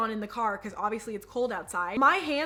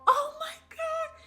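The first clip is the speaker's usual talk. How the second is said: crying; slower than usual. Retold or imitated. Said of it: "starts crying".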